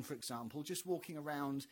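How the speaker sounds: ordinary talk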